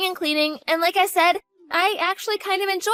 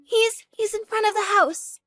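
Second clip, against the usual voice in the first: panicked; 5 semitones higher than usual. Acted. Daughter panics as father arrives.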